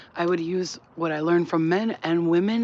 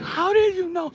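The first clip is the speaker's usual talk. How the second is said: mock amazed woman; 12 semitones higher, 4 dB louder, slower than usual. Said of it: "she's like".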